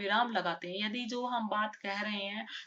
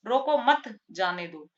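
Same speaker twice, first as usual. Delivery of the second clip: louder, slower रोको मत, then जाने दो; pause after मत; 6 dB louder than usual. Shown as a demonstration. Teacher shows the next reading of the sentence, pause after मत. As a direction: keep रोको मत together, break, then जाने दो. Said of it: "इसको देखो".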